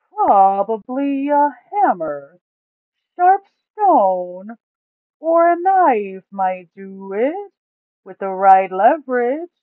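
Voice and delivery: high, loud, slow put-on voice for the greedy Duke. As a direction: drag the words out, high and loud, savouring the loot.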